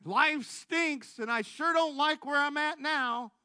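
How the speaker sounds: fed-up inner voice reasoning with him; voice in his head. Retold or imitated. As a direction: let his own head talk him into it. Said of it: "but then my head said".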